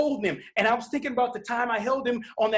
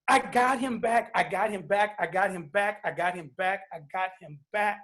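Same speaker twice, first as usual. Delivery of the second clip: overcome with emotion; 4 semitones lower than usual. Real, not acted. Father telling how he got his son back, overcome. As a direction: let each repeat sink further, moved and barely holding on. Overcome, repeats son's return.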